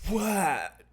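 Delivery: sudden high, stunned "What?"; shocked what. Retold or imitated. Retelling shock at the news.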